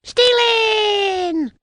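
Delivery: very high, loud, drawn-out cheer; little men's shout. A narrator's reading. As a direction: shout it as a rowdy tiny crowd. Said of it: "shouted the blue men".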